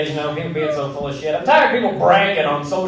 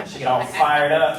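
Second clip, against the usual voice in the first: normal talking voice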